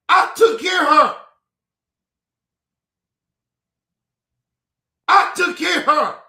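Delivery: high, loud, indignant shout; angry shout. Real, not acted. Insisting he looked after his pregnant sister, shouting angrily. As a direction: shout it like proof nobody can deny.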